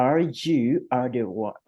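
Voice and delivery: ordinary talk, nothing marked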